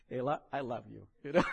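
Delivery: said through a laugh. Real, not acted. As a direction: laugh while typing the password.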